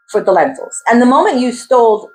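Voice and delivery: normal talking voice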